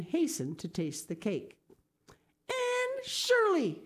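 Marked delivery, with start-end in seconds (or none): 2.5-3.8 high, slow, louder cry of the name; shocked exclamation. A narrator's reading. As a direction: scold the girl in alarm, drawn out. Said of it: "she exclaimed".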